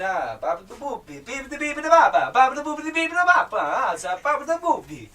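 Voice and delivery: loud mock-Italian gibberish, rising high; excitable nonsense in a put-on Italian accent. Shown as a demonstration. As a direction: babble like a caricature Italian.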